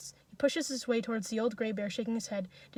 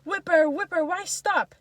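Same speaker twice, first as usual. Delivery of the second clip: high, loud goading shouts; repeated jeering yells; crowd egging on; 7 semitones higher, 8 dB louder, slower than usual. A narrator's reading. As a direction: call it out urgently from the crowd. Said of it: "Voices from the crowd".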